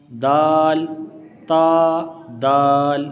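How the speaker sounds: تا and دال said thin and light; long, unthickened تا and دال; thin letters. Shown as a demonstration. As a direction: keep دال light, drawn out. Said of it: "یہ باریک ہے".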